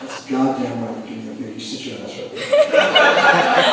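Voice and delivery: low, gruff bossy tough talk; sit him down like a boss; mock tough guy. [name] ordering the buyer to sit, low mock bossing.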